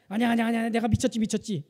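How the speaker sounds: put-on husband loudly refusing another baby; emphatic refusal. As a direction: shout down the idea. Husband's refusal retold louder.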